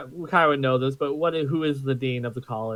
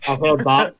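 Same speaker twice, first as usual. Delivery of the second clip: loud; 7 dB louder than usual. Acted. Repeats retort, louder.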